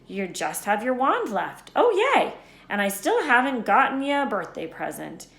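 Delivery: half-play Hagrid, amazed Harry knows so little; Hagrid voiced. A hint of Hagrid exclaiming to Harry.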